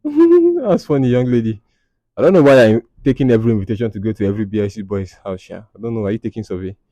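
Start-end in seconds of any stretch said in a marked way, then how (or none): 0.0-1.6 high drawn-out laugh through the words. Real, not acted. Amused at a viewer's post, laughing.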